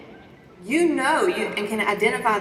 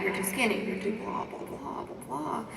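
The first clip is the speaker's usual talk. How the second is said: fading to a whisper; 10 dB quieter than usual. Retold or imitated. Gremlin's list fading out.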